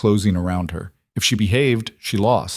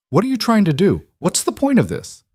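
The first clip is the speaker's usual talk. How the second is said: pitched-up angry shouting at Mara; angry outburst; 11 semitones higher than usual. Acted. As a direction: lash out at her in anger.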